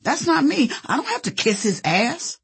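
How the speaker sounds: high-pitched, indignant refusal; righteous. A narrator's reading. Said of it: "I was all righteous".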